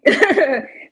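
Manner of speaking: loud burst of laughter. Real, not acted. Saver laughing about where her money goes. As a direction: laugh at your own spending.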